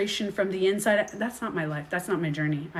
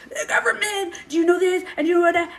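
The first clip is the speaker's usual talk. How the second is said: much higher, louder mock outrage; imitating angry cousins; 11 semitones higher, 6 dB louder than usual. Cousins lecturing her about the government, higher and louder. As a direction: play the fired-up cousin lecturing you. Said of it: "you're so angry".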